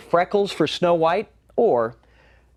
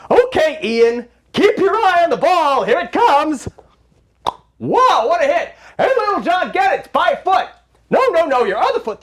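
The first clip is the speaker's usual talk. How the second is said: much higher and louder excited calls; calling out in game; 10 semitones higher, 6 dB louder than usual. Acted. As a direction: cheer the kids on. Calling plays in pretend baseball.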